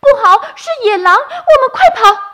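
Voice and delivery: very high, loud, fast panicked shout; loud cry. A narrator's reading. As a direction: scream a warning to run. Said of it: "雪雪大声地说". (雪雪惊慌大喊)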